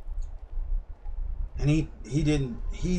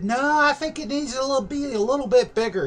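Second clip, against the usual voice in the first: put-on girlfriend voice; 11 semitones higher than usual. Girlfriend quoted, high voice.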